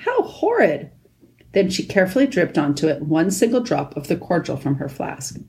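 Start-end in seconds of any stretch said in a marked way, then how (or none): 0.1-0.9 high-pitched exclamation of dismay. A narrator's reading. Lucy reacts to the nasty wound, dismayed.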